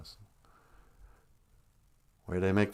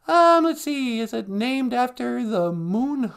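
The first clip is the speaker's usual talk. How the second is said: very high character voice, drawn-out guess; sidekick voice; 12 semitones higher, slower than usual. Pretend. Sidekick working out what Monday means, high character voice. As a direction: think aloud in a high put-on voice.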